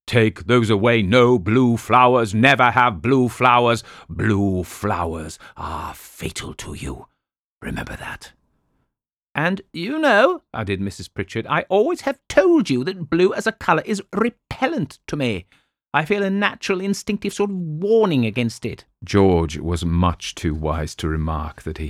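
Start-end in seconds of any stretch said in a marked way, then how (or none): 0.1-8.3 slow, grave prophecy sinking to a whisper; ominous fortune teller warning. Retold or imitated. Fortune teller warning wife against blue flowers, slow and ominous. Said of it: "She pointed at them and cried out". 9.3-18.9 fretful invalid wife. Acted. Wife adds her view.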